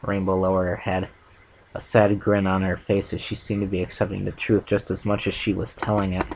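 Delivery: ordinary delivery, nothing marked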